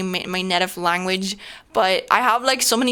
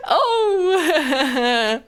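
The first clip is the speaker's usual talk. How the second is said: laughing; 8 semitones higher than usual. Real, not acted. Laughing fit after accent talk.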